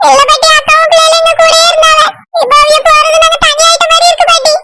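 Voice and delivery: put-on high-pitched young boy voice; high boyish voice, upset over big sister; little brother. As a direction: plead to grandma as a small boy, pitched well up.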